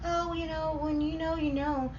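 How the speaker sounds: high, soft mock-dreamy voice; making fun of lovestruck people; mocking imitation. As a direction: mimic a smug romantic, high and hushed. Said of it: "I used to make fun of people when they would say".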